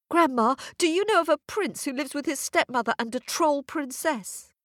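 put-on high, quick, eager girl's voice; a hopeful girl rushing her question; young girl asking. Girl asking the old woman for the way, high and eager.

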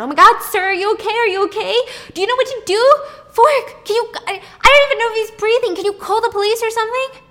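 very high, loud, frantic cries; panicked. Retold or imitated. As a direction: panic over a collapsed man. Man collapses on street.

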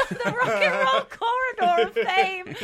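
high, loud, laugh-filled words. Real, not acted. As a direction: laugh all the way through it. Laughing at a silly name.

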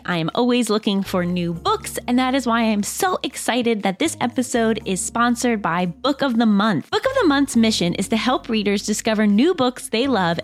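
upbeat sponsor read, clear and even. Acted. Host reads a book club ad to listeners, upbeat ad read. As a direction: sell the book club like a friend.

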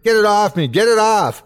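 high, urgent, panicked cries; panicked yell. Acted. Captive begging Everett to pull the creature off, panicked. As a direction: yell frantically for help. Creature attacking the bound narrator.

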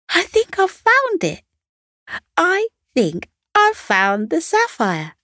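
high, bright-eyed announcement of a find; excited. Acted. Sunny calling out to the other dogs, excited. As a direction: blurt it out like you can't believe your luck. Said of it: "waved her paw excitedly and said".

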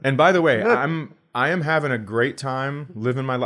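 normal talking voice

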